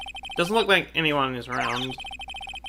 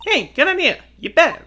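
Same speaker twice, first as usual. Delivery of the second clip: put-on southern accent; 12 semitones higher, 5 dB louder than usual. Answering Lotta's boat idea, in a Southern accent. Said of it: "got me talking in southern accents".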